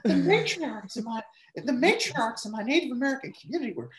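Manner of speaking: high-pitched politician impression; hammed-up, high mock-politician voice; Warren. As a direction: pitch it way up into a put-on voice. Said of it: "can you do a Warren?".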